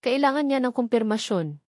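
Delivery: plain reading voice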